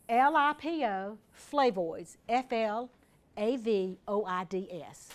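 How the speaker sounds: slow, letter-by-letter dictation; spelling out. Shown as a demonstration. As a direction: spell it slowly so they can copy it. Spelling a product name for listeners.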